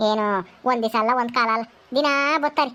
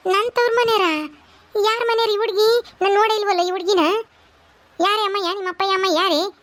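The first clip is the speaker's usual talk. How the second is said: astonished questions; 5 semitones higher than usual. Acted. Surprised at bride's origin.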